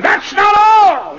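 high, loud, drawn-out cry; slow preacher's shout; mounting outburst. Acted. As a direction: burst out as the horror mounts. Rector's confession builds.

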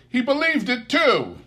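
merry exclaim. A narrator's reading. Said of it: "cried Scrooge's nephew".

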